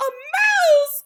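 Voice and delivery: high, startled witch cry; witches crying out. A narrator's reading. Witches reacting to the Grand High Witch, high shocked cry. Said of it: "cried the witches".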